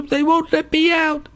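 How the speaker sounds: put-on high, creepy teenage boy voice; young boy's voice. Showing the old person's creepy voice, high boyish pleading. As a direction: pitch it up like an eerie boy. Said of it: "It's a young boy".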